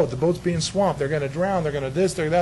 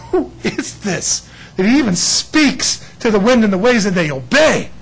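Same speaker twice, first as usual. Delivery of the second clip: awed disciples; 5 semitones higher, 7 dB louder, slower than usual. Retold or imitated. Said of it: "the apostles are going".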